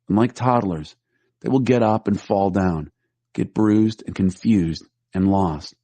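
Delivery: normal talking voice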